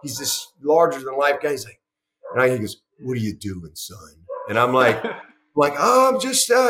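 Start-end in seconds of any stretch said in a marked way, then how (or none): 3.0-4.0 lower, softer, a lightly voiced big gruff coach; big man's voice. Former strength coach quizzing young coach, lightly voiced. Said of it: "he's got this voice". 5.8-6.7 flustered. Retold or imitated. Flustered reply to intimidating man.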